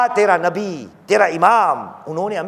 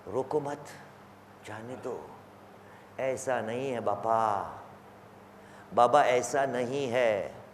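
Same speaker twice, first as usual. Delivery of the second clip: very soft, low murmured pleading; low, subdued appeal; hushed plea; 5 semitones lower, 12 dB quieter than usual. Acted. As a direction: drop right down and plead quietly.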